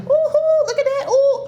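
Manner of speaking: high, loud whoops of delight; giddy, high-pitched oohing; excited cooing. Retold or imitated. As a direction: squeal over a wonderful find, acting it out. Acts out her fabric-store delight.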